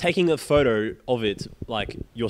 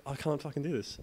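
softer, under-the-breath gripe; muttered curse; 2 semitones higher, 10 dB quieter than usual. Retold or imitated. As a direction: mutter it to yourself, fed up. Retold frustration at museum.

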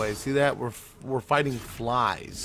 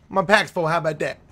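mock indignant; 4 semitones higher, 5 dB louder than usual. Shown as a demonstration.

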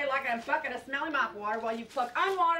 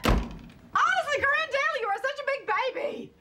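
exasperated scolding; 10 semitones higher than usual. Acted. Mother scolding her grown daughter, exasperated and high.

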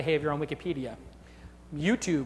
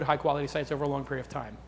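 ordinary talk, nothing marked

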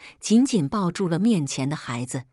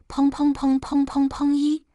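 door pounding; 8 semitones higher than usual. Acted. Mother-in-law pounding door.

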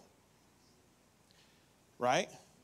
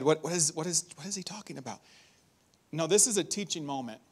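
normal talking voice

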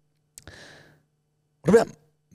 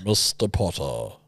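slow, low, softer drawn-out professor; Severus Snape; 6 semitones lower, 5 dB quieter, slower than usual. Shown as a demonstration. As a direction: stretch the name out like a sinister teacher. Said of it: "that's what he does".

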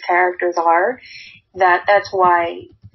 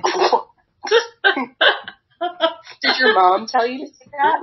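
mock baffled reaction; 6 semitones higher than usual. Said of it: "I would be like".

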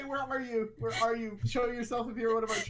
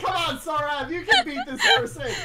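high, loud urging through laughter; laughing encouragement; 8 semitones higher, 8 dB louder than usual. Real, not acted. Urging Saurav to beat this person, high laughing shout. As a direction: cheer them on while cracking up.

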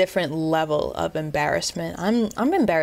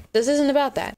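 bright laughing denial; 9 semitones higher than usual. Real, not acted. Laughing off the joke.

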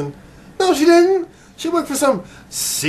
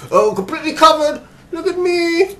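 much higher mocking impression of the actress; 4 dB louder than usual. Reviewer mocking the Chun-Li actress, in a much higher mock voice. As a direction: parody her in a much higher pitch.

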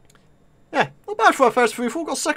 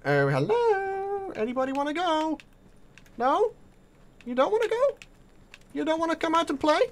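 teasing taunt; 5 semitones higher, 6 dB quieter than usual. Pretend. Gamer teasing hidden enemy players, in a high sing-song taunt. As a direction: coax the hiding enemy out mockingly.

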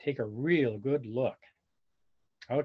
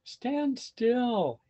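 higher, begging parent voice; pleading parents; 9 semitones higher than usual. Acted. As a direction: plead with the fidgety chick. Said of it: "his parents pleaded".

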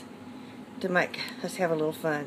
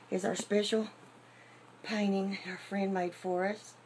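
ordinary delivery

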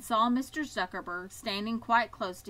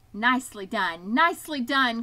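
loud cry of praise; goose's cheer; 2 semitones higher, 9 dB louder than usual. A narrator's reading. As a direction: cheer loudly, rising on the repeat. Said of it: "cried the goose".

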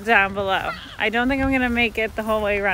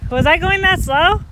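surprised; 8 semitones higher, 8 dB louder than usual. Real, not acted. Surprised after running the stairs.

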